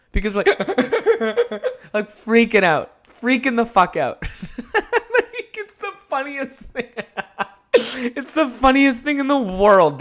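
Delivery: breaking into real laughter while talking; words squeezed out through big laughs; laughing. Real, not acted. Laughing hard recalling friend.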